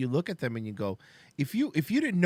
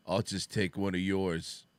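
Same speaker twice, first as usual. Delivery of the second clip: low, slow, understated deadpan; a hint of Mike answering dryly; 8 semitones lower, 5 dB quieter, slower than usual. Host quotes Mike from Better Call Saul, low deadpan. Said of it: "He's like".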